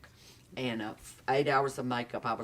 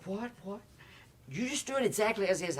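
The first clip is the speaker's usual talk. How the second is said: higher, hurried mock panic; flustered; 8 semitones higher than usual. Casting director begging him to change nothing, mock flustered. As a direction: imitate a nervous, sweating director. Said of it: "'Cause she was sweating".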